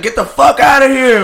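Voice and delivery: panicked yell. Retold or imitated. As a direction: shout as you run from the leak. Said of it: "I was like".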